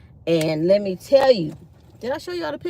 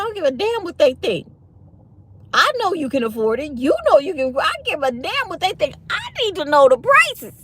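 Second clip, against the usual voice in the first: defiant retort; 7 semitones higher than usual. Retold or imitated. Arguing with boyfriend, defiant.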